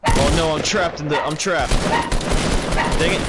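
raging gamer. Real, not acted. Trapped in basement mid-fight.

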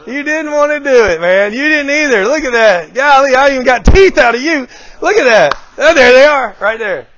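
high, loud playful teasing; teasing a smile out. Retold or imitated. Speaker teasing an audience member into smiling, excited and loud. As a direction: coax a grin out of someone, grinning. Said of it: "I could take somebody to smile".

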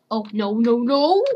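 ordinary talk, nothing marked

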